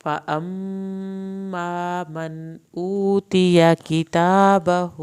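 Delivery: slow, loud, drawn-out tajweed chant; Quran recitation. Shown as a demonstration. Teacher recites the verses aloud for students, slow loud tajweed chant. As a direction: chant each verse long and full in tilawat style.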